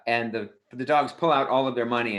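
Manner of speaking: usual speaking voice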